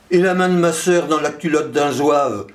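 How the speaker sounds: cried out. A narrator's reading. Last, lettered voice shows off learning, crying out. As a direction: call it out high, without a full shout. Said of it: "criait enfin le dernier".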